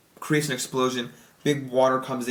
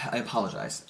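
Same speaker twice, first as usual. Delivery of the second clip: ordinary talk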